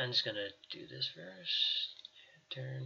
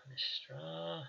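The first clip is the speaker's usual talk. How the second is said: whisper; 5 semitones higher, 9 dB quieter than usual. Acted. GM whispers name to the table.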